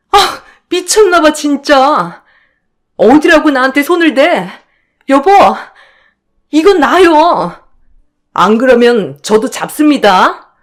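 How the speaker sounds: high, sharp outraged voice; angry shock. Acted. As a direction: fight back with her hair grabbed. Hair pulled, fighting back.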